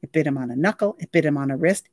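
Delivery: usual speaking voice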